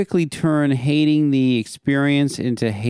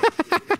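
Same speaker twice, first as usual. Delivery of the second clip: laugh. Real, not acted. Host laughs at sprinkler news.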